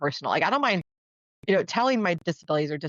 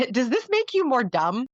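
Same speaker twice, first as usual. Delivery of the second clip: mock naive question; 6 semitones higher than usual. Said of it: "favorite ones I got".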